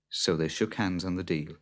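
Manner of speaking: ordinary delivery, nothing marked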